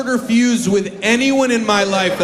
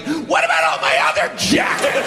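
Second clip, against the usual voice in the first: indignant outburst; 7 semitones higher than usual. Retold or imitated. Overreacting to mom's jacket compliment.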